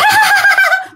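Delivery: very high, shrill witchy laugh; creepy demon cackle. Acted. Demon boasting of its power, creepy high cackle. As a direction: cackle to frighten the master. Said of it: "Cười thấy ghê lắm".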